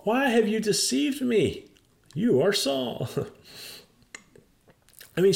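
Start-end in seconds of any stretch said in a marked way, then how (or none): 0.0-3.1 mock terrified. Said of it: "She's terrified".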